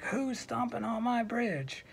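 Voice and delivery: high-pitched, mildly indignant troll challenge; woken troll. Acted. Troll woken again, challenges goat.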